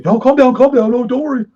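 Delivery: urgent calming shout. Retold or imitated. Captain talking down his upset sailor, shouted urgently. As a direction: shout it high and loud, insistent. Captain retold urging him to calm down.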